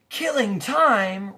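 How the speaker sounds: very loud, high, slowed roar; furious roar. A narrator's reading. Watchdog outraged at Milo, furious roar. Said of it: "roared the dog".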